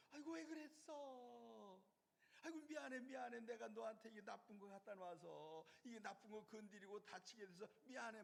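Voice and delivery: put-on high, soothing coo to a hurt baby; tender baby talk. Mother comforting her hurt baby, high tender coo. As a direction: apologise sweetly to a baby. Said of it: "막 그러지요".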